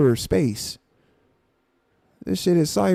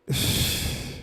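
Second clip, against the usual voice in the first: a soft, breathy sigh of disbelief; heavy sigh; 6 dB quieter than usual. Real, not acted. Reacting to the clip with a weary sigh. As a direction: let out a tired sigh.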